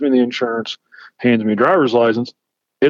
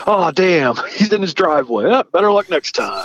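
higher-pitched sham regret; mock disappointment; 7 semitones higher than usual. Cop pretending to give up at the driveway, mock disappointment. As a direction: play up the letdown. Plays a cop giving up chase.

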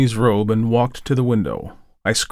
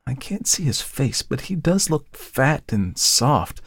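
put-on musing young woman; 5 semitones higher, slower than usual. Model musing.

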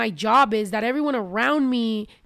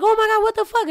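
shocked disbelief; 8 semitones higher, faster than usual. Retold or imitated. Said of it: "I was like".

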